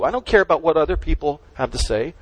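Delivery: ordinary delivery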